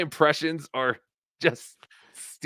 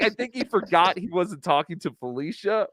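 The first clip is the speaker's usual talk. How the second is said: ordinary delivery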